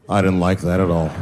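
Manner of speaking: низкий голос белого парня. Retold or imitated. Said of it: "was like".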